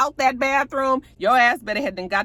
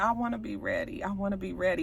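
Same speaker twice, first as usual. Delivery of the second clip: softly, with little voice; quiet and hurt; 9 dB quieter than usual. Retold or imitated. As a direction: say it quietly, almost to himself. Hurt man answers quietly.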